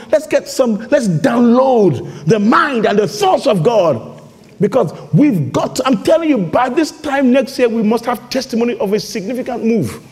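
high-energy preaching, pressing each point; fired-up preaching. Real, not acted. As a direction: exhort the congregation with rising fervour.

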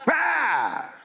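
drawn-out bleated "ah", falling away; long mocking "aah"; mock feeble "ah". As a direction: imitate a disliked limp sigh aloud. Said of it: "I hate that sound in this country".

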